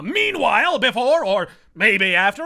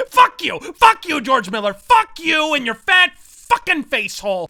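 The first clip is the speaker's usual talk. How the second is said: loud, high outburst, each curse punched; furious rant; 4 semitones higher, 6 dB louder than usual. Acted. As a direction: hurl each curse at the director, stretching the last.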